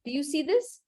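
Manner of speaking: normal talking voice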